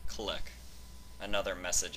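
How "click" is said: plain reading voice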